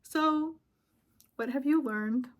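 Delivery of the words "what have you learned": normal talking voice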